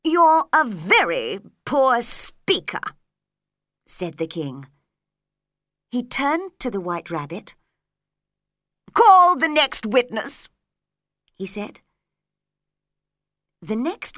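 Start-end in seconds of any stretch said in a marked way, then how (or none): 0.0-2.8 slow, louder royal scorn; scornful king. Acted. King scolding the Hatter, slow and scornful. 8.9-10.5 higher, louder order; commanding king. Acted.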